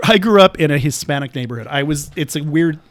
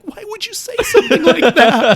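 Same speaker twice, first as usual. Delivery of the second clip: high-pitched, appalled disbelief; mock shock; 13 semitones higher than usual. Upset employee reacting to the boss's blunder, mock shocked. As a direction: imitate a scandalized colleague, voice shooting up. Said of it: "the guy literally almost passed out".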